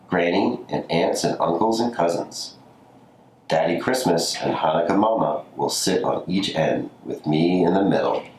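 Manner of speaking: usual speaking voice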